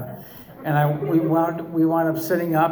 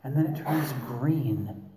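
imitating guided meditation voice; 8 dB quieter than usual. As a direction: lull them like a therapist leading a meditation. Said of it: "modulate your voice like that and talk like this".

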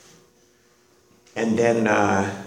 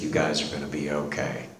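much softer and lower, gently calming them; soft reassurance; 9 semitones lower, 11 dB quieter than usual. Retold or imitated. Father reassures his wife.

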